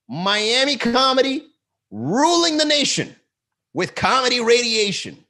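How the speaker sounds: high, loud, slow announcer proclamation; hype slogan. Pretend. Comic hyping his comedy brand, high loud slogan. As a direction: boom it out like a promo tagline.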